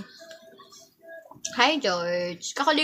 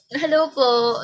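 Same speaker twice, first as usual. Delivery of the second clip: normal talking voice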